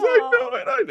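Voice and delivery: very high, loud mock crying; imitated bawling. Mom overwhelmed by the casting news, mock bawling. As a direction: imitate a mother wailing happy tears. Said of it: "she starts bawling, crying".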